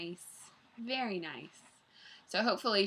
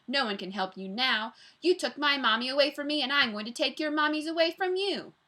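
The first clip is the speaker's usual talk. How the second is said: mild villain's taunt, rising and louder; raised, mocking storybook villain; sneering; 5 semitones higher, 5 dB louder than usual. A narrator's reading. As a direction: build a sneering threat upward. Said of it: "he sneered".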